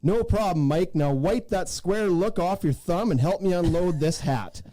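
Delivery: ordinary talk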